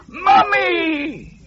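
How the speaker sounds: high, sobbing shout for his mother; terrified child crying out, high; crying scream. A narrator's reading. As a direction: scream it through tears. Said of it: "screamed Nigel".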